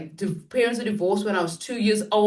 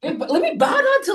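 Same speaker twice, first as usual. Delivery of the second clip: mock worship; 10 semitones higher, 4 dB louder, faster than usual. Mock bowing to the other guests.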